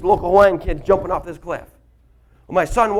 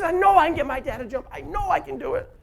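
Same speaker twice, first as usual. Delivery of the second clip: put-on bragging kid; 7 semitones higher than usual. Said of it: "He says".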